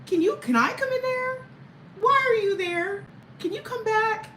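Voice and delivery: put-on very high, whiny little child voice; pleading toddler. Toddler at the kitchen gate begging her mother to come back, very high and whiny.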